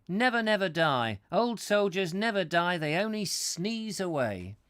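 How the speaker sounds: high, loud theatrical soldier voice; Roman centurion. Acted. Centurion quotes the soldiers' song to the visitor, high declaiming voice. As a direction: pitch it up and declaim the wall song.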